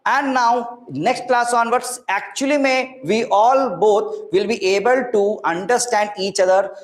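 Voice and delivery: usual speaking voice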